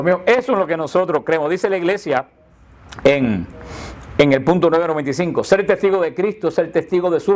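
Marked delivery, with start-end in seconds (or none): none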